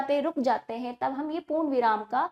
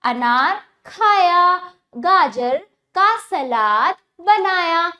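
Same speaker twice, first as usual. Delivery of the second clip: slow drawn-out reading; 3 semitones higher, 7 dB louder, much slower than usual. Shown as a demonstration. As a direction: stretch every आ in आज राजा बाजार, slow and loud. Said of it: "इस तरह आप मेरे साथ उच्चारण कीजिए". (बच्चों के लिए आ खींचकर धीरे और ज़ोर से पढ़ना)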